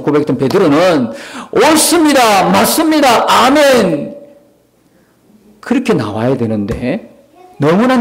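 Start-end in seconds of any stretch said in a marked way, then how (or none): 1.5-4.2 eager agreement. Acted. Peter eagerly agreeing with Jesus.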